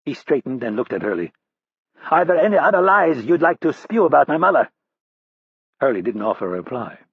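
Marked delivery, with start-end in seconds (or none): 2.1-4.7 higher, louder jeer; mocking taunt. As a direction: throw it in his face. Roddy taunts Hurley.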